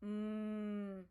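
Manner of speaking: closed-mouth hum, drawn out; slow steady hum; humming demo. Shown as a demonstration. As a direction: hum with lips shut. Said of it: "देखो मुंह बंद हो रहा है ना".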